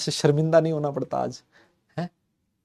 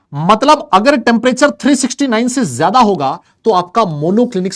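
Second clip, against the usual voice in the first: loud emphatic explanation; 6 semitones higher, 6 dB louder than usual. Real, not acted. Teacher stressing the condition.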